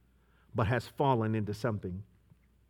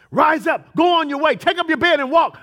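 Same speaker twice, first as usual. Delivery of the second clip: commanding call; 14 semitones higher, 11 dB louder than usual. Acted. Voicing God commanding.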